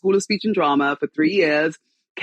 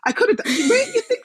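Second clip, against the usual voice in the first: laughing; 9 semitones higher than usual. Real, not acted. Laughing at host's joke.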